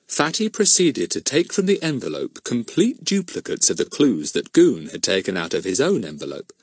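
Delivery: plain reading voice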